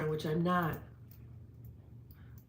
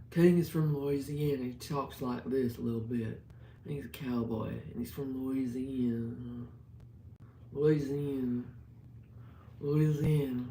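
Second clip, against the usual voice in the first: Louisiana accent; 4 semitones lower than usual. Shown as a demonstration. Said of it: "That's one of my specialty accents right there".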